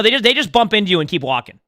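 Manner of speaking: ordinary talk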